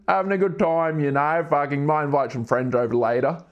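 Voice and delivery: hammed-up Aussie bloke, matey and chatty; thick, put-on Aussie accent; exaggerated Australian accent. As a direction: play a broad Australian larrikin. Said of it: "speaking with this accent".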